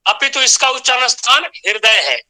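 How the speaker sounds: usual speaking voice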